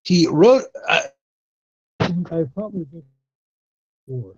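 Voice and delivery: choppy, dropping out phone line; bad connection. Real, not acted. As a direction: voice breaking up over a bad line. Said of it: "you're breaking up a little bit there".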